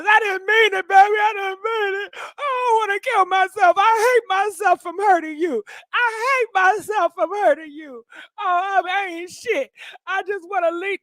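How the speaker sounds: high, fake-crying plea; mock sobbing apology. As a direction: wail out a hammed-up sorry man. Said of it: "feel sorry for what they did to her and apologize".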